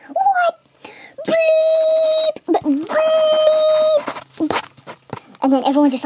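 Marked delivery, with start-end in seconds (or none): 0.1-4.5 squealed, very high censor bleeps; a high yelp into long squealed bleeps; imitated outraged cussing. Everyone reacting to only five keys, squealed cussing. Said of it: "everyone's all like".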